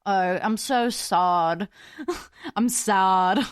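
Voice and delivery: mock British accent, drawn out on "sad". Vlogger explaining the word sod, mock British accent. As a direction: put on a playful British accent. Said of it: "but with a British accent".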